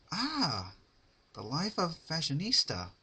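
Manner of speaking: high, dreamy sigh; Rarity musing in the sunlight. Acted. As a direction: lounge on the words, pleased. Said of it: "she murmured to herself".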